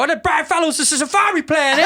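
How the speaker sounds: very high, loud, hammed-up sketch voice; Tracy Morgan. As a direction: squeal it out, tripping through the S's. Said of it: "But Tracy would go".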